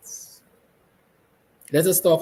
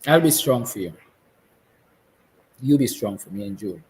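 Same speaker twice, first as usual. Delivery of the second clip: getting emotional; 3 semitones lower than usual. Real, not acted. Father to his children, voice thick with emotion. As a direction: speak to your family through welling emotion. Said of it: "I'm getting emotional".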